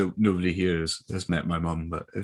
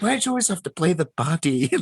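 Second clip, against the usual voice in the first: mum; 8 semitones higher than usual. Retold or imitated. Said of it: "She's like".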